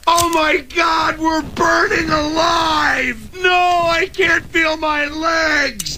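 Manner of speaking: high, drawn-out screams of agony; shrieking crash victims, acted out; screaming family. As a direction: wail it slowly in hammed-up terror. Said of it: "your family's screaming".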